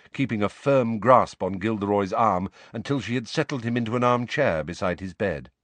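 plain reading voice

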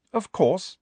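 high, cool, brisk reply; coolly self-assured, lifted pitch. A narrator's reading. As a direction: answer as if it's obvious. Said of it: "said Hermione coolly".

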